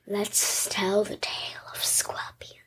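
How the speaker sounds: whispered, hushed tale-opening; spooky storyteller whisper. Pretend. Girl whispering to open her scorpion story. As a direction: breathe it out like a campfire story.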